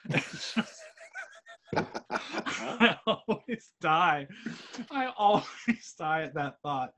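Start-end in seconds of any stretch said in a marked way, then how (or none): none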